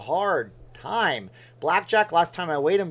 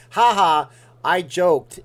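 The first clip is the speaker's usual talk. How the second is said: loud, higher-pitched imitation of a gloating friend; big mocking ha-ha, voice raised; 4 semitones higher, 10 dB louder, slower than usual. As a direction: crow over the prank, hammed up. Friend mocking him in retold prank.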